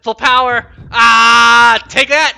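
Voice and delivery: high, very loud battle yell; real all-out victory shout; triumphant shout. Real, not acted. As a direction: scream it as you strike the final blow. Final attack in the game.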